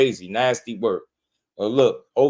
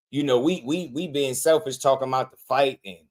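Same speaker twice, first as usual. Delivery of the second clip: ordinary delivery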